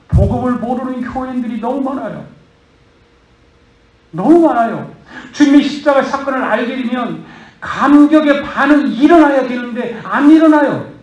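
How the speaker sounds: loud, high, impassioned sermon; fervent preaching. Acted. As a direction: preach it hard to the congregation. Pastor preaches at full force. (큰 소리로 열정적으로 설교)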